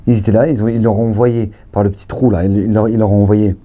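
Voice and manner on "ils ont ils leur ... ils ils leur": ordinary talk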